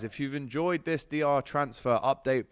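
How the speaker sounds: ordinary talk, nothing marked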